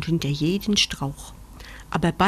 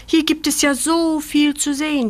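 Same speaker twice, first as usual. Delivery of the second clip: amazed; 8 semitones higher, 4 dB louder than usual. Acted. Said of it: "staunt Julia".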